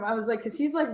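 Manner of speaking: usual speaking voice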